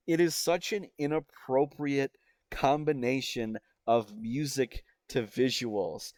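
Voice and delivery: ordinary delivery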